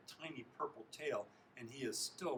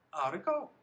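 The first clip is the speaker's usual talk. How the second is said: high, loud eager question; parent asking; 6 semitones higher, 11 dB louder than usual. A narrator's reading. Parents ask about field day at dinner, eager. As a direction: ask with keen parental interest.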